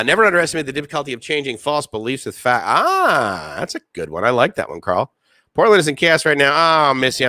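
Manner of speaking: ordinary talk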